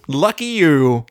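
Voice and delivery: sarcastic. Retold or imitated. Discord sarcastic.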